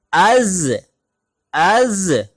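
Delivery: अज closed hard on the ज़; firm stopped za. Shown as a demonstration. Teacher demonstrating jazm: firm अज. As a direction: press the final ज़ in अज firmly. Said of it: "थोड़ी सी सख्ती के साथ होगी".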